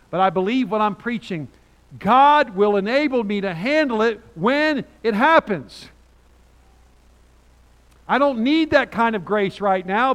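loud, rising preacher's voice; preaching at full force. Real, not acted. Pastor preaching hard to his congregation, loud and fervent. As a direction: drive the point home, loud and urgent.

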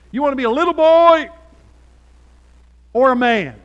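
high, booming challenge; shouted challenge. Retold or imitated. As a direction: holler the question at a teenager. Challenge repeated to teenager.

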